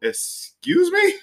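very high, very slow "excuse me"; incredulous. Real, not acted. Co-host hearing there are carrots in the gumbo, drawn-out high disbelief. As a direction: stretch it out in outraged disbelief.

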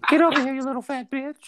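put-on high, creepy old man snarl; Herbert voice. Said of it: "I could mimic that voice".

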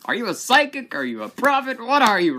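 high, loud amazed questions; excited. Retold or imitated. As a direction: blurt it out in amazement. Excited disbelief at friend.

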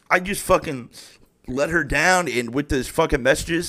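usual speaking voice

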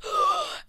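sharp high gasp of alarm; mock shocked gasp. Friend imitating Paige looking at her phone, sharp high gasp. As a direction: gasp like the phone just buzzed bad news. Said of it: "being like".

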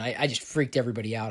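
normal talking voice

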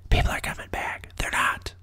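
hushed, unvoiced aside; whisper. Breaking it to those hoping L A recovers, whispered. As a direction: whisper it like a secret.

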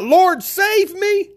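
high, drawn-out plea for rescue; crying out. Retold or imitated. Sinking Peter cries out to the Lord, an acted high desperate cry. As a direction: act out a slow, high-pitched cry for help. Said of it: "Peter cried out".

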